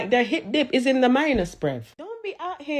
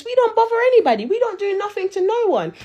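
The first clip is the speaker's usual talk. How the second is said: fast exasperated protest; 9 semitones higher than usual. Real, not acted. Jamaican vlogger protesting to commenters, fast, high and indignant. Ranting at other islands' commenters.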